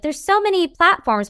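a hint of a fussy old lady. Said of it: "I sound like an old lady now".

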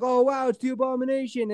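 lightly voiced impressed crowd; mock awe. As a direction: gush at a celebrity. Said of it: "they're like".

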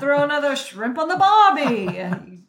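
hammed-up, loud Australian opener; boisterous mock-Australian announcement; put-on Australian accent. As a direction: play up a cartoon Aussie accent. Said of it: "get ready for some hot Australian accents".

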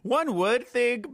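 high, slow and sassy; drawn-out sassy aside. Real, not acted. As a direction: stretch it out, full of shade. Sassy drag retort.

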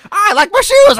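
high, loud creepy clown. Pretend. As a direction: squeal it like an evil clown. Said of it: "I made up, like, a clown voice".

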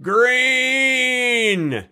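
high drawn-out yell. Retold or imitated. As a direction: shout it from the back row. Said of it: "would just go".

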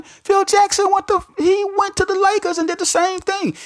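fired-up, almost yelling the point; high-pitched hype; excited shout. Real, not acted. Hyped argument about Phil Jackson.

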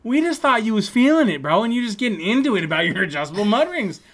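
high, loud comic exclaiming; astonished mock protest. Classmates to tripping friend after argument, loud astonished protest. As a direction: protest loudly in acted amazement.

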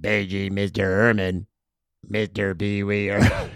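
a hint of a stiff low bellhop; bellhop page. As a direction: quote it like a wooden actor. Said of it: "this line lives forever in my head".

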